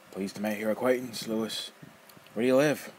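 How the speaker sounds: loud, rough, mocking old man; teasing old rogue. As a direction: toy with the kid, loud and gleeful. Nefer voice.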